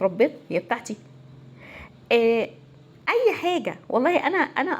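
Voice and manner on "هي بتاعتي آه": usual speaking voice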